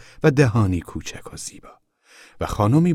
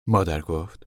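usual speaking voice

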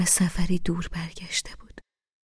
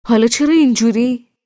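a touch of stern seriousness, louder; serious; 6 semitones higher, 10 dB louder than usual. Acted. Mohammad questioning Amir's rough joke, serious tone. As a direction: ask it gravely. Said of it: "محمد جدی پرسید".

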